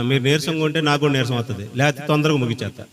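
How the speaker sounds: usual speaking voice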